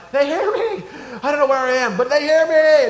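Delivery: very high, loud mock jubilation; hammed-up thrill at being found; acted elated relief. Lost dad relieved to be heard.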